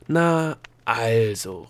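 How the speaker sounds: gnome exclaiming in relief; relieved call. A narrator's reading. Gnome to the official as if the matter is settled, relieved. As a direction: call it out, relieved, dropping on the second word. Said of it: "rief der Gnome erleichtert".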